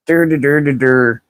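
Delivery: dumb-sounding mock babble; dismissive dur sounds; mocking Gen X bragging with dumb dur noises.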